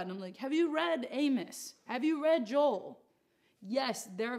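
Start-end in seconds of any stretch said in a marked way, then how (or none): 0.4-3.0 slow, louder rhetorical questions; slow punchy preacher retort; emphatic challenge. Acted. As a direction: press each question home.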